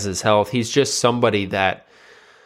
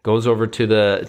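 ordinary delivery, nothing marked

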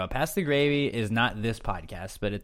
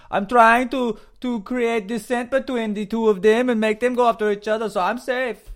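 high, louder mock J C voice; J C impression; 12 semitones higher, 5 dB louder than usual. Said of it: "That was pretty good, J C".

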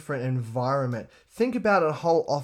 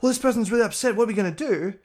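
mock worried coworker; 8 semitones higher, 3 dB louder, faster than usual. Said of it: "someone ever came to me and said".

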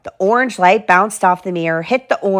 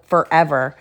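plain reading voice

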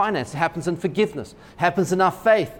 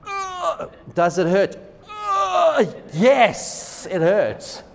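hammed-up pained groan, then wincing yes; mock agony in a doctor's office; 5 semitones higher, much slower than usual. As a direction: groan like the prod really hurt, half in jest. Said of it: "tears are coming down your eyes".

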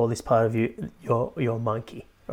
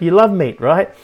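louder, higher mocking mother; teasing mum; 4 semitones higher, 8 dB louder than usual. Mum teasing her vegetarian son, louder mock. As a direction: needle your son about his meat. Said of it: "She's like".

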